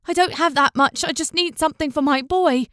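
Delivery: high, pleading voice; desperate plea. A narrator's reading. Poor mother pleading with the fisherman, desperate. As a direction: beg him to understand.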